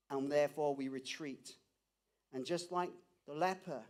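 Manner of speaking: hushed, intimate sermon voice, pulled back and close; soft tender preaching. Acted. Pastor quietly reassuring the ashamed in the room, soft and tender. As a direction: speak gently, as if to one hurting person.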